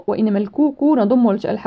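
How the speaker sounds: usual speaking voice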